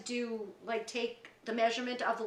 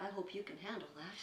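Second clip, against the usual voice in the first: very soft and low, retold; subdued warning; 5 semitones lower, 14 dB quieter than usual. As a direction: drop it to a grim murmur. Groggy patient warns her daughter.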